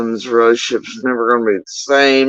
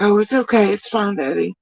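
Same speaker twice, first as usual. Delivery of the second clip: put-on higher, light little-girl reassurance; pitched-up sing-song mock of a forgiving child; mock forgiving daughter; 6 semitones higher, 3 dB quieter than usual. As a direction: imitate a girl brushing it off for Daddy. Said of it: "and she's like".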